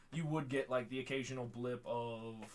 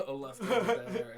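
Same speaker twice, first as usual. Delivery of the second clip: laughing; 9 semitones higher, 10 dB louder than usual. Acted. GM laughs in NPC voice.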